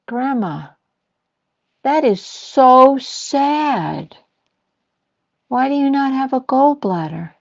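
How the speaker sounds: put-on a small boy solemnly pitying grandma; very serious little boy. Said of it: "very serious".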